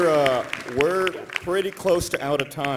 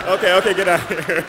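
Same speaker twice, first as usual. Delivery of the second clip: laughing; 3 semitones higher, 7 dB louder than usual. Real, not acted. Laughing, shooing speaker offstage.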